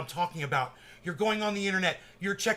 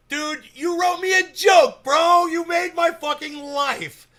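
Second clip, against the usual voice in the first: real delighted yell, much higher and louder; excited shout; 8 semitones higher, 8 dB louder, slower than usual. Real, not acted. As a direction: shout your joy at him. Thrilled at fan's joke.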